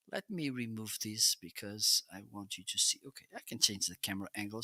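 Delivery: ordinary talk, nothing marked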